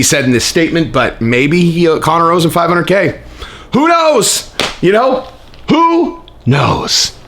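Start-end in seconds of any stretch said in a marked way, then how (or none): 3.7-7.2 high, sing-song who knows; mock shrug. Real, not acted. Mocking shrug.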